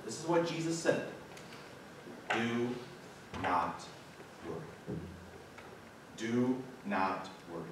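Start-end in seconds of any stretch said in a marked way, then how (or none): 2.3-5.0 each word set apart by long pauses, low and soft; said slowly. Shown as a demonstration. Pastor to his congregation, spelling out the command slowly and softly. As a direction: drop the voice and space out every word. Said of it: "I'm gonna say it slowly".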